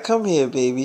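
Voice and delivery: low, soft sweet-talk; retold soothing, dropped low and quiet; coaxing boyfriend. Boyfriend coaxing his upset girlfriend.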